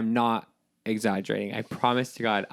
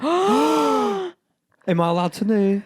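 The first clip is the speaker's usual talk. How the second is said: excited; 10 semitones higher, 4 dB louder, much slower than usual. Real, not acted. Excited tarot card reveal.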